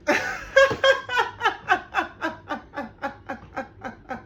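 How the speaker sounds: a long high laugh of disbelief; high real laugh. Real, not acted. Hearing the client dodge the question, a high laugh of disbelief.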